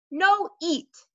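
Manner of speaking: put-on toddler refusal. A narrator's reading. Fudge refusing food to his mother, stubborn toddler voice.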